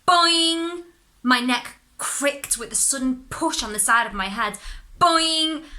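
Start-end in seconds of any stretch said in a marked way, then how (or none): 0.1-0.8 high, loud springy boing; boing sound. Acted. Narrator voicing the spring that hits his head, loud boing. 5.0-5.6 high, loud springy boing; boing sound. Acted. Narrator voicing the spring that hits his head, loud boing.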